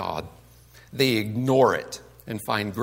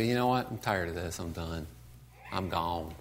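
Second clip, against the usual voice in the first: low, quiet giving up; weary resignation; 9 semitones lower, 9 dB quieter than usual. Acted. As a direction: act out quitting, voice dropped low and soft. Imagined believer giving up on faith.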